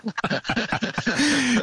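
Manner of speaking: high-pitched burst of laughter; real amused laugh. Real, not acted. Joking about the uphill climb.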